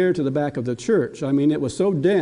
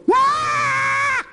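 put-on very high, sudden toddler shriek; a baby's piercing yell; baby's shout. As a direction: grandpa imitating the toddler shrieking at him.

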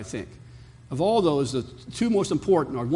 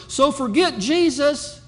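slow, scornful mock reading of the author; 10 semitones higher, 5 dB louder, slower than usual. Pastor mocking the scientist's words to his congregation, slow and scornful. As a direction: sneer it out slowly, mocking the claim. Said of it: "And this is what he wrote".

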